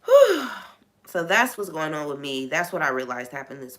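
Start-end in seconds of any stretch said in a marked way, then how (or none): 0.1-0.5 exhale. Real, not acted. Exhausted venting.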